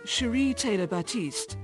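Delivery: usual speaking voice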